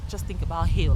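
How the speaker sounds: usual speaking voice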